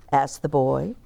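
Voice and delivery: ordinary delivery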